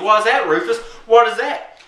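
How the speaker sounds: high, insistent mock neighbor; Jarney. Neighbor demanding to know the threat, high loud mock challenge. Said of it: "this is my impression of him".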